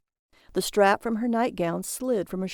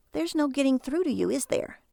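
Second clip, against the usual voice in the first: higher, exasperated wife; 6 semitones higher than usual. Acted. Wife despairs of Barry, higher and weary. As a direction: give up on him.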